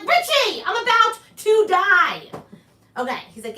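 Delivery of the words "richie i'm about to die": much higher and louder, a mock frantic cry; panicked yell. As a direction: shriek it like death is coming. Panicked reply to Richie.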